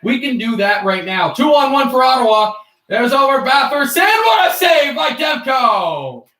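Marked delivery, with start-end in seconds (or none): 1.3-6.3 loud, high hockey call building to a stretched shout; broadcaster impression. Streamer impersonating broadcasters on a Demko save, excited play-by-play shout. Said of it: "I impersonating broadcasters and playing calls".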